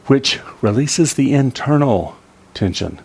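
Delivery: usual speaking voice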